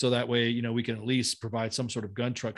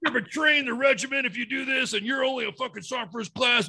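much higher, louder, mock-furious sergeant major; mock chewing out; 10 semitones higher, 4 dB louder than usual. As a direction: ham up a superior chewing him out. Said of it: "getting my ass chewed out".

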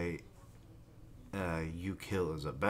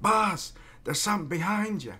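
much higher, louder squeaky goblin warning, fast; goblin minion; 12 semitones higher, 7 dB louder than usual. Retold or imitated. Goblin orc warning his boss, high panicked squeak. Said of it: "some of the goblin-looking orcs are like".

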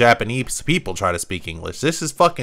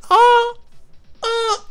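put-on much higher, breathy girlish gasp; innocent girl gasp. Gamer mocking the innocent idol character, high girlish gasp. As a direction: mimic her flustered innocence. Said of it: "She's like".

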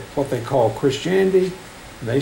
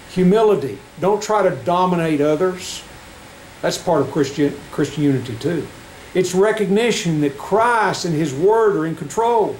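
raised, ringing pulpit voice; fervent preaching; 5 semitones higher than usual. Acted. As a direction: preach it to the congregation. Preaching to congregation.

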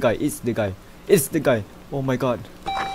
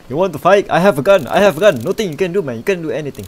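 loud, raised-pitch bluster; mock threatening shout; 6 semitones higher, 9 dB louder, much faster than usual. Player threatens a game intruder with a gun, mock shout. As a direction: play at scaring off an intruder, loudly.

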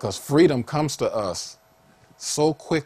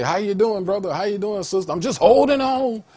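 high-pitched mock greeting and reply; loud, exaggerated 'just holding on'; churchgoers' small talk; 11 semitones higher, 6 dB louder than usual. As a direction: act out the pity-party answer, high and strained. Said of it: "they talk like that all the time".

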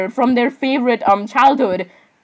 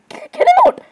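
squeaky high outburst. Acted. Squeaky outburst reacting to video.

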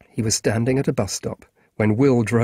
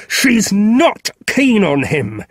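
loud, slow, higher shouted denial; sudden shout; 10 semitones higher, 6 dB louder, much slower than usual. Acted. Boy Ally yelling at Marcus, loud and slow. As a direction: bellow it at him, drawn out. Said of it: "Ally suddenly shouted".

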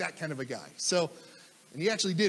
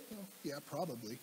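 reluctant mumble; 6 semitones lower, 14 dB quieter than usual. Retold or imitated. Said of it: "reluctant answers to Charlie".

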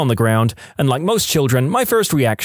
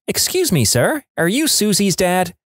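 high-pitched imitation of a preschooler; small child voice; 6 semitones higher than usual. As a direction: pipe up like a curious little kid. Narrator voices herself as a preschooler.

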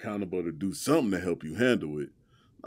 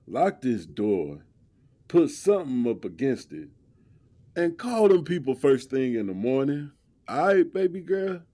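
rising higher and louder with each instruction; urgent protective; 6 semitones higher, 3 dB louder than usual. Acted. Boyfriend urging girlfriend to lock up, building urgency. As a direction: press her to stay safe, building up.

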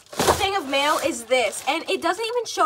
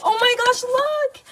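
high, delighted squeal at the card; high thrilled oh my gosh; excited squeal; 7 semitones higher than usual. Real, not acted. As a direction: gasp then squeal it out, real delight. Gasps at the card.

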